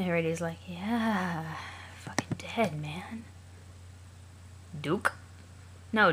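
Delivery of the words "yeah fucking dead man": whisper. Acted. Voiced troll line, whispered.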